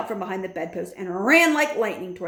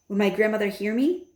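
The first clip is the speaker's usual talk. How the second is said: usual speaking voice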